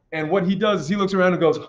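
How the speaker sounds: ordinary delivery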